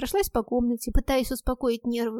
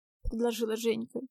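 usual speaking voice

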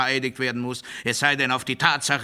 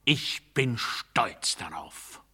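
slow whisper after the shouting; whispered pride; 6 semitones lower, 6 dB quieter, much slower than usual. Acted. Speaker confiding his pride to the audience, in a slow whisper. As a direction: drop to a slow, hushed whisper. Sudden drop after the oratory.

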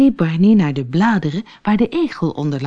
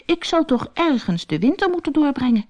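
worried little stoat; 10 semitones higher than usual. Acted. Barney worries aloud.

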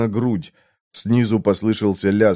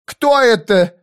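high, loud frightened woman; yelling woman; 11 semitones higher, 5 dB louder, slower than usual. A narrator's reading. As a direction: yell in alarm. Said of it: "завопила".

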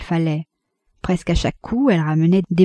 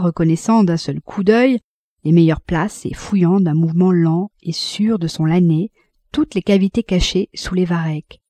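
plain reading voice

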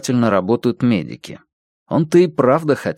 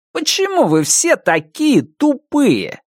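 much higher, slow whiny troll; 14 semitones higher, slower than usual. Acted. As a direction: drag it out, contemptuous and high. Follower tweet voiced.

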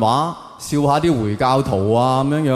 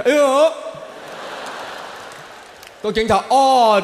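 mock startled yelp; 9 semitones higher than usual. Mock reaction to seeing police.

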